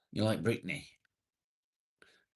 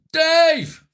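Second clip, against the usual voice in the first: delighted shout; 17 dB louder than usual. Real, not acted. Streamer greets a viewer arriving in chat, shouting with delight. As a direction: yell Dave! much higher and much louder in delight.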